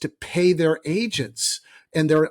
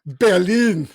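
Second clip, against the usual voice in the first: put-on Berlin with a German accent, louder; mock German accent; 2 semitones higher, 5 dB louder than usual. Mocking a German accent for Berlin, louder. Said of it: "He does the same kind of thing with, with the German".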